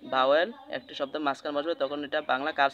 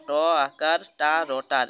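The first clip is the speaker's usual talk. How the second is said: ট আকার, টা, র, টার spelled slowly and louder; slow, louder step-by-step spelling; 6 dB louder, slower than usual. Shown as a demonstration. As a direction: spell it letter by letter for the class. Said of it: "এদিকে একটা আকার চিহ্ন আছে".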